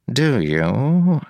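usual speaking voice

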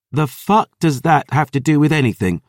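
loud, high angry snap; angry retort. Acted. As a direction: spit it back at her. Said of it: "the Grail spat".

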